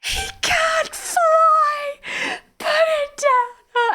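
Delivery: high, slow wailing cry; mock screaming kids. Kids on the floor wailing over the touched elf, high mock cry. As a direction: wail it like a distraught child. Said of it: "kids screaming on the floor going".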